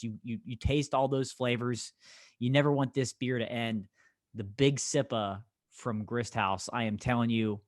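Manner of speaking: ordinary delivery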